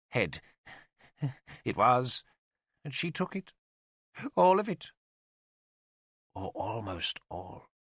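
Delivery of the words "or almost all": slow, softer, trailing admission; hushed and slow, as an afterthought; whispered. A narrator's reading. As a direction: let it sink toward a whisper, slowly. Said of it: "He whispered the last three words".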